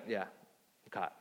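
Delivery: sheepish. Retold or imitated. Sarah caught lying to God.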